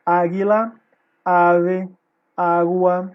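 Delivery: "águila, ave, água" punched loud on each opening A; "águila, ave, água" with a strong initial A; stressed first syllable. Shown as a demonstration. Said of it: "é a tônica".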